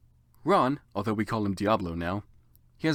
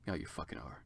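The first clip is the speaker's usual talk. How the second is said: much softer, low muttered retort; deadpan aside; 8 semitones lower, 16 dB quieter than usual. Real, not acted. Reader mocking the narrator, quietly deadpan. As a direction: throw it away under your breath. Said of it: "aside retort".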